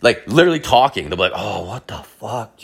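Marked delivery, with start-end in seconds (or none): 1.3-2.6 quiet, drawn-out groaned words; slow, soft mock straining; straining moan. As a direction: imitate a guy groaning on the toilet. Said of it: "grunting and moaning".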